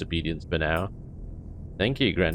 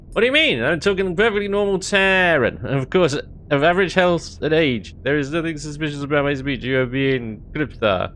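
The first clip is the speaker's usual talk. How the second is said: defensive alien voice; 6 semitones higher than usual. Acted. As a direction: insist you sound normal, pitched up. Alien insisting its speech is normal.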